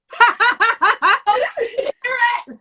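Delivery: high, loud burst of cackling; real helpless laughter, pitched high. Real, not acted. Caught talking about Sam, laughing loudly.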